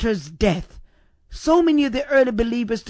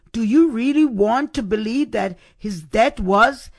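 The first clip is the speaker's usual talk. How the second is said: normal talking voice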